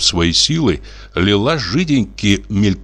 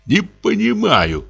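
slow, higher-pitched muttering husband; mumbling; 14 semitones higher, slower than usual. Acted. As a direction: mutter it with rising agitation. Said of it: "забормотал он".

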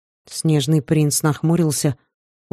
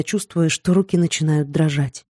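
plain reading voice

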